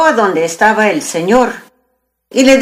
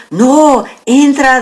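frightened; 5 semitones higher, slower than usual. Acted. Frightened man ordering the devil back into the hide, mildly. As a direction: blurt it out in a scare, lightly. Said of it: "Tuvo miedo el hombre".

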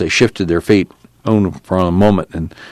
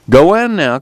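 higher, sharp command; 9 semitones higher, 3 dB louder than usual. A narrator's reading. Casey ordering Al to go in, sharp. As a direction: snap the order out. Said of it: "Casey said sharply".

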